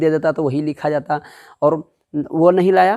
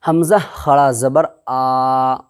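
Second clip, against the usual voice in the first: slow, drawn recitation of letters; spelling out syllables; 3 semitones lower, 3 dB louder, much slower than usual. Shown as a demonstration. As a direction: sound out each letter slowly like a Quran teacher. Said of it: "देखो क्या है".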